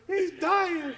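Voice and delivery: high, loud mock alarm; imitated panicked shout. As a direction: cry it out like a parent rushing in. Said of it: "the father or, uh, or whoever".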